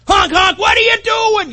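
angry driver yell. Retold or imitated. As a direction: blast it like a furious driver. Road rage at another driver.

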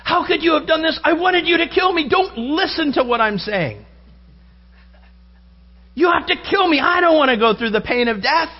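high, pleading hammed-up voice. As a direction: beg to be killed, comically desperate. Retold movie plea to be killed.

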